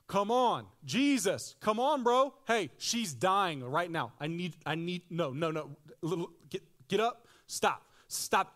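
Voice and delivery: loud, high, frantic pleading; desperate father. Retold or imitated. Father begging Jesus to hurry to his dying daughter, frantic. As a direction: beg Jesus to hurry, panicking. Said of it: "this guy is like".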